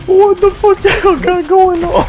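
shocked. Real, not acted. Shocked outburst in game.

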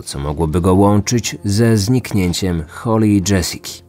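ordinary talk, nothing marked

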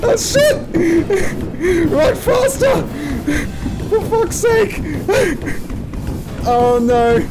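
very high panicked shrieking; being chased. Real, not acted. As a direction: squeal while running for your life. Fleeing Huggy Wuggy.

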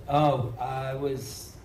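usual speaking voice

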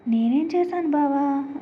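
high, slow feigned innocence; sweetly faking innocence to her cousin; innocent. A narrator's reading. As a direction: play dumb, wide-eyed and slow. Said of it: "అమాయకంగా మొహం పెట్టింది".

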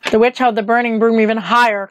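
usual speaking voice

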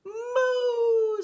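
loud moos climbing to a very high long one; rising, swelling cow calls; exultant mooing. A narrator's reading. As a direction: build to a big celebratory moo. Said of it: "said the pig".

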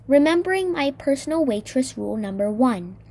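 plain reading voice

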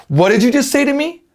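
high, slow, rising disbelief; acted indignant challenge. Teacher repeats a line to imitate, indignant and incredulous. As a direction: demand it like an offended film character. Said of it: "真似してください".

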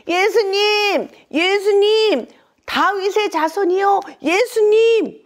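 high, drawn-out shouts; loud calling. Acted. Blind men calling to Jesus through the crowd, loud high cries. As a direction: call out over a crowd, stretching the name. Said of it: "큰 소리로 예수님을 부르기 시작했어요".

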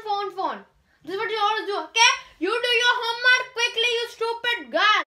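put-on high, fast scolding Indian-accented mother; amateur Indian accent. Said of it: "my Indian accent is getting a".

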